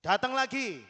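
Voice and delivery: seru keras dengan nada tinggi; seruan memanggil. Acted. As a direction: panggil orang berikutnya dengan lantang. Memanggil orang berikutnya. (seruan lantang)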